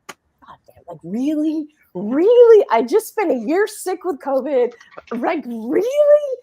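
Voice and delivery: loud, high disbelieving outburst; exasperated rant at God. Retold or imitated. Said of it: "I'm literally mad at God".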